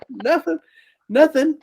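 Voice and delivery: high squeaky nothing; sheepish denial. Retold or imitated. Jamie denies laughing to Jay, high and sheepish. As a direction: innocent high-pitched denial.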